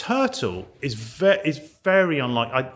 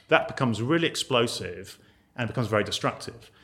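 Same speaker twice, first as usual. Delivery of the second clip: ordinary talk, nothing marked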